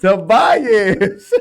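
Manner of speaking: loud laugh through "Tobias", falling at the end; laughing name. Real, not acted. Insisting on her favorite son's name, laughing loud. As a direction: say "Tobias" laughing, landing it as the sure answer.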